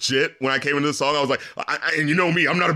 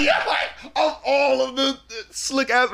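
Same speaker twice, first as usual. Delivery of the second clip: excited; 7 semitones higher, slower than usual. Real, not acted. Incredulous laughing high.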